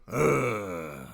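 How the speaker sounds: a lazy rumbling growl like a bored dirt bike. Shown as a demonstration. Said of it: "a bored dirt bike noise".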